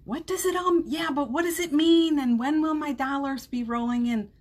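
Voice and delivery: a hammed-up anxious whine; mock anxious fretting. Shown as a demonstration. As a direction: play the worried mind, half joking. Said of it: "Think about it that way".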